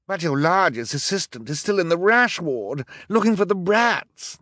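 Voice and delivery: high, loud character voice; henchman's protest. A narrator's reading. Henchman warns his boss about a missing accomplice, protesting. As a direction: raise an alarmed objection.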